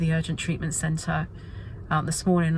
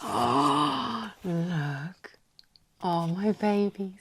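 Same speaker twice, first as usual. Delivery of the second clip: soft, slow, doting coo; tender; 3 semitones lower, 3 dB quieter, much slower than usual. Real, not acted. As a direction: coo over them lovingly. Tender over finished stamps.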